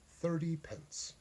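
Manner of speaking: usual speaking voice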